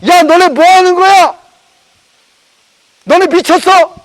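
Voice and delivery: angry crowd yelling. Acted. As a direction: yell at them like they're mad. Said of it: "막 욕을 하기 시작합니다".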